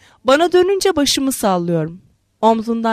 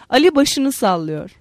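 plain reading voice

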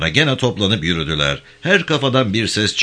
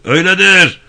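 much higher, louder slip of a yell; accidental shout; 12 semitones higher, 8 dB louder than usual. Acted. Prisoner accidentally shouting his agreement during a secret talk. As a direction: blurt it out too loud by mistake. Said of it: "yanlışlıkla bağırdı".

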